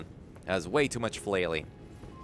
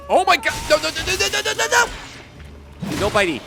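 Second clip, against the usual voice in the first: high frantic no no no; panicking, voice shooting up; panicked; 6 dB louder than usual. Real, not acted. Attacked in game, panicking.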